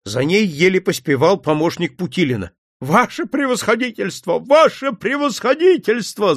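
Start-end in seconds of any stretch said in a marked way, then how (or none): 2.8-4.4 a higher, first wailing cry. A narrator's reading. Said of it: "завопила она". 4.4-6.3 much higher, fuller wail; louder wailing. A narrator's reading. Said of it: "завопила она".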